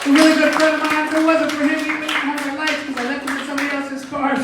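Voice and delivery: loud, high club emcee intro; host hype. Acted. Emcee bringing up the next comic, high and loud announcer hype. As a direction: pump up the room for the next act.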